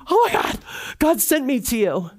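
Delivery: excited disbelief at meeting her; overjoyed exclamation. Retold or imitated. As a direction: gasp it out like a prayer answered. Retelling meeting her mentor.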